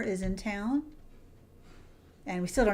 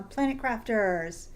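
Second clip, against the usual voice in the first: ordinary talk, nothing marked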